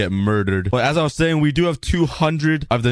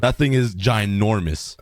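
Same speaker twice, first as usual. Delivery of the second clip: ordinary delivery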